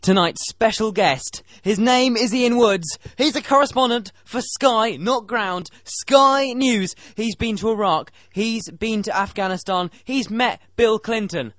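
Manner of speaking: loud, slow showman build-up; excited introduction. Real, not acted. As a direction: announce the guest like a big star. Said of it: "I'm really excited to introduce".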